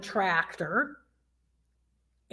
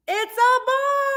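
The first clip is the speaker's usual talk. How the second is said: excited reveal. Pretend. Reveal to children.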